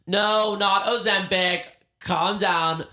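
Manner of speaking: high, loud comic objection; loud protest. Real, not acted. Telling listeners it is not Ozempic, loud indignant protest. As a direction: shut down the assumption loudly. Heading off the audience's assumption.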